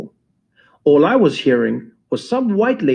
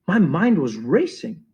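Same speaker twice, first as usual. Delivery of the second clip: usual speaking voice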